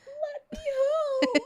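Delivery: very high, drawn-out mock wail; squealed, hammed-up cry; mock shouting. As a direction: imitate a desperate plea, stretching each word. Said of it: "driving around my little village shouting".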